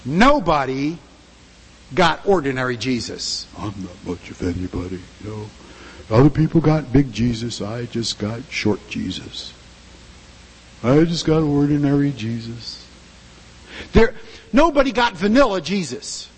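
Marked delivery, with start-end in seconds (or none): 3.6-12.8 self-pitying low mutter. Acted. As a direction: whine like a believer who feels shortchanged.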